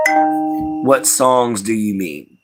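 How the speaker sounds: ordinary delivery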